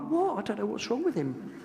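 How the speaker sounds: sudden high, innocent 'who me' voice; mock innocent dad. Dad feigning innocence to mum, high and innocent. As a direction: play the dad pretending he did nothing. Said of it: "then he'd look at my mum like".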